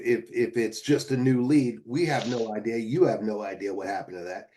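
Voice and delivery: ordinary talk, nothing marked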